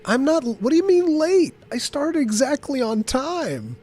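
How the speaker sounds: high, slow indignant protest; mock indignant. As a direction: playfully protest the accusation. Mock denial of starting late.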